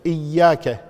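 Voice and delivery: إياك with a long, pressed double ya; doubled ya. Shown as a demonstration. Teacher pointing out a shadda, doubled ya in إياك. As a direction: hold the "yy" of إياك. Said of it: "مشددة".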